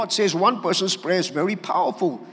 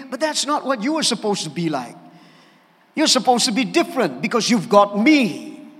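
ordinary talk, nothing marked

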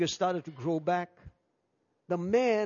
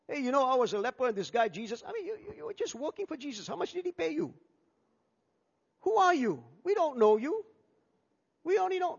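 mocking doubters; 6 semitones higher, faster than usual. Skeptical crowd mocking healed man.

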